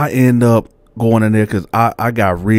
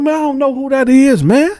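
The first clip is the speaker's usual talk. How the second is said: high, loud, fast protest; retold frantic denial; heated denial; 3 dB louder than usual. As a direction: deny it hotly. Denying it again.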